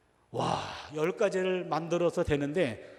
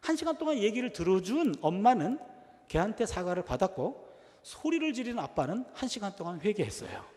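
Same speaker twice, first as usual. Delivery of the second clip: normal talking voice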